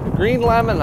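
loud, high show-title call; announcer voice. Acted.

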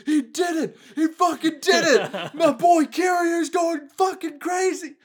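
high, stretched-out fanboy yelling; hammed-up screaming celebration; mock hype frenzy. Imitating fans running round the room cheering, high excited yelling. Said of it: "run around the room going".

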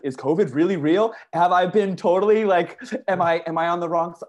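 replaying a spiral of fear; paranoid panic. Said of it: "so sketched out".

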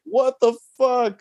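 high, slow, drawn-out what-the-fuck; jaw-dropped squeal at the reveal; stunned disbelief. Retold or imitated. Fan seeing the surprise cameo, squeaky stunned disbelief. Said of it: "I was like".

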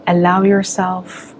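calm meditation guide with long pauses; unhurried, measured visualization voice; guided meditation. Acted. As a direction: lead them into relaxation, phrase by phrase.

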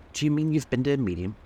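humorous. A narrator's reading. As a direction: ask it with a touch of humor.

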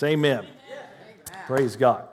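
ordinary talk

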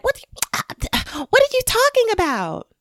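high, squeaky mock-innocent question; mock dismissive voice. To people who gaslight her, a high mock-clueless voice. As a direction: play dumb like someone brushing her off. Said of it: "And they're like".

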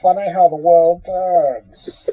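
slow villain line, laughing through; villain voice cracking with laughter; Bane voice. Pretend. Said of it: "Bane is here".

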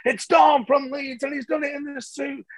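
slow, high announcer shout; announcer call. Retold or imitated. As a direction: call his name over the finish line. Announcers calling him in.

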